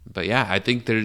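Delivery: ordinary talk, nothing marked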